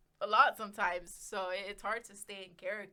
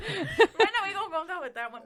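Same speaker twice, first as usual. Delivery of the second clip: laughing; 11 semitones higher, 14 dB louder than usual. Retold or imitated. Joking imitation, laughing.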